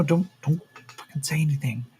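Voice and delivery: hissed warning whispered urgently; frantic whisper. Acted. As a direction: whisper sharply to shut him up. Farmer hushing Yakim among captors.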